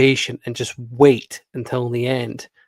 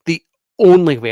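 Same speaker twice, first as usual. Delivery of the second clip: "only" loud, high and stressed; emphatic; 5 semitones higher, 11 dB louder than usual. Real, not acted. Vlogger disputing film's two or three days, emphatic. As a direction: punch "only" well above your usual voice. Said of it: "and I mean the".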